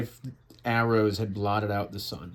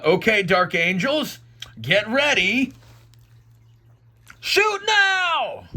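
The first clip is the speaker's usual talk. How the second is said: rising from raised call to high shout; battle command; 10 semitones higher, 4 dB louder than usual. Acted. Commander orders dark angels to shoot, building shout. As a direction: build to a high, drawn yell.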